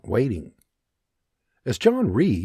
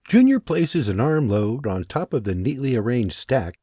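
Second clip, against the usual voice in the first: ordinary delivery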